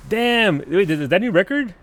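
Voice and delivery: high, loud, excited reaction; thrilled astonishment. Real, not acted. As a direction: marvel out loud at a possible record. Thrilled at possible record launch.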